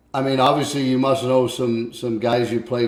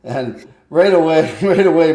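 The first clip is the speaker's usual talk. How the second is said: ordinary talk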